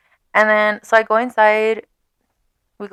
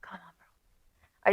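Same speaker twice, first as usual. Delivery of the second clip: slow unvoiced whisper; 10 dB quieter, much slower than usual. Real, not acted. Scolding herself in a slow whisper. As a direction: breathe it out in a whisper. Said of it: "self-scolding aside".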